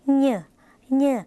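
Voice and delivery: "เหย่อ" through the nose as nyoe; nasal ny sound. Shown as a demonstration. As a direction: say "เหย่อ" with a nasal ny, twice with a pause. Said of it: "ออกเสียงขึ้นจมูกเป็น".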